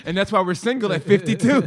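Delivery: loud laughing punchline. Real, not acted. Joking to Dion about being single, laughing loud. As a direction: laugh through the joke.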